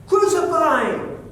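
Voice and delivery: loud, high angry yell of a mob; crowd's shout. Retold or imitated. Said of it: "they shouted out back".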